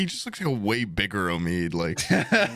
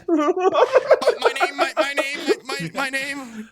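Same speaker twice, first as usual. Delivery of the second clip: high, laughing, stuck repeating my name; higher laughing stutter of a tongue-tied fan; stammering fan; 12 semitones higher than usual. Retold or imitated. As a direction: mimic a starstruck fan who freezes up. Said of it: "And he's like".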